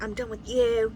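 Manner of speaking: a hint of a mean boyfriend; mock him back, lightly voiced. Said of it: "he's just being mean".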